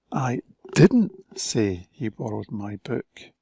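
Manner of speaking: "didn't" punched, slow delivery; slow, emphatic "didn't"; stress on didn't. Shown as a demonstration. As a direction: lean hard on "didn't". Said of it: "different emphasis on different words".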